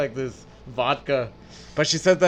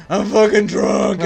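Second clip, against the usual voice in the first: slow, loud mock drunken wail; drunk crying mother; 5 semitones higher, 6 dB louder, slower than usual. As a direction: imitate a tearful drunk, high and dragged out. Voicing his drunk, crying mother.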